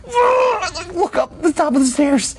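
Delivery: very high, drawn-out comic shriek; mock terrified cry. Daring to look back up the stairs, hammed-up terror. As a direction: strain it out high and slow, hamming up the dread. Acting out the scared look upstairs.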